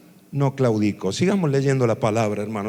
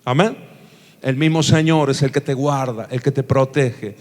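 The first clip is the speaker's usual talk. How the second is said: ordinary delivery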